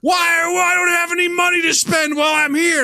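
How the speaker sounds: high, loud mock outrage; whiny indignant guest shout. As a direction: yell it like an indignant hotel guest. Angry guest retold, shouting.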